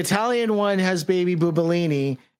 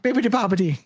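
mock Italian; much faster than usual. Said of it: "she's Italian now?".

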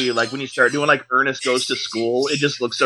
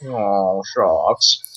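a lightly voiced sheepish Ernest, a bit lower; Ernest aw shucks; 5 semitones lower than usual. To her co-host, mimicking Ernest's sheepish frown, lightly voiced. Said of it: "All he has to do is just frown and be like".

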